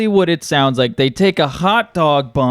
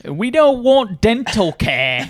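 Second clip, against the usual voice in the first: higher-pitched hammed-up British voice; mock British accent; 6 semitones higher than usual. Brit refusing dental care, mock British accent. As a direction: chant it like a cartoon Brit. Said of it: "I like the British act".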